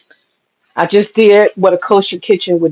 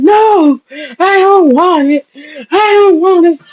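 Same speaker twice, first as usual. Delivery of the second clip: high, slow mock crying whine; hammed-up child crying no; imitating a child's crying fit; 8 semitones higher, slower than usual. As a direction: act out a kid sobbing in refusal. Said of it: "She was acting all crazy".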